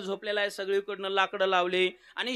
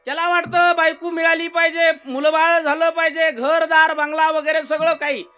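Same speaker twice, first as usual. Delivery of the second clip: higher, louder sermon voice; rousing kirtan preaching; preaching at full force; 8 semitones higher, 7 dB louder than usual. Acted. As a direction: preach it out to the hall.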